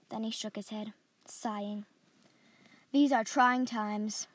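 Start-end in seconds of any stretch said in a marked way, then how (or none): none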